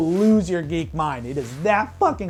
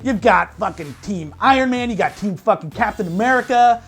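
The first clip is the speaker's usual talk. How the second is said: raised and louder, tumbling out with fanboy glee; excited gushing; 5 semitones higher, 3 dB louder than usual. Real, not acted. Reviewer raving to viewers about the airport fight, excited and loud. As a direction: rave to the audience like you can't contain it.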